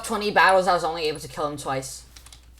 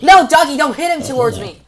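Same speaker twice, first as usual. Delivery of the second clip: panicked yell; 9 semitones higher, 11 dB louder than usual. Real, not acted. Yelling at dog.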